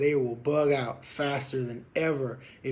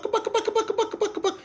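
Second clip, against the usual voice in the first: much higher, laughing buck buck buck; high goose bucks. Shown as a demonstration. Instructor contrasting plain clucks with treaded double cluck, high-pitched. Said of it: "not so much the buck".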